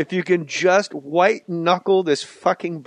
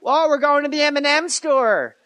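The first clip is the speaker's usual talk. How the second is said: high, excited starstruck fan voice; gushing fan; 12 semitones higher than usual. Retold or imitated. Iowa tourists meeting Farley, gushing fan. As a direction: squeal it like a thrilled tourist from Iowa.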